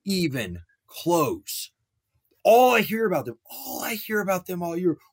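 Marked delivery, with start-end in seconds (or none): 2.4-5.0 high-pitched mock of gushing praise. Sports host parroting praise for an overrated defense, mocking. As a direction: mimic the hype in a high, exaggerated voice.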